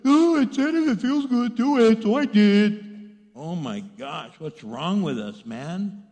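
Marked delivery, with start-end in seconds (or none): 0.1-2.9 put-on loud, high dopey-kid yell; hammed-up protest of a clueless child; kid making excuses. Child answers angry parents.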